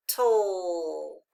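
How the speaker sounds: "toll" drawn out, falling; long "toll" sinking in pitch; isolated final syllable. Shown as a demonstration. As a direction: stretch "toll" as one lone syllable. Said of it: "the final stable syllable".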